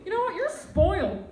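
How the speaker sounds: hammed-up furious mom; mom meltdown. Mom's Christmas meltdown, acted out.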